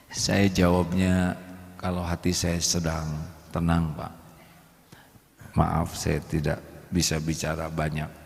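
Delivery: quiet, low gentle answer; wife calmly and quietly declining to argue; calm soft reply. Pretend. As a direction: answer softly to defuse him.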